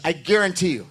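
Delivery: ordinary talk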